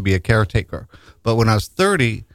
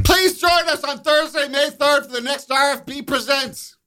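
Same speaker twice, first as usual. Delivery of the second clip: bright announcer ad read. Acted. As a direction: read it like a radio promo. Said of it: "reads ad aloud properly".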